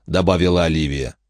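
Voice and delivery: plain reading voice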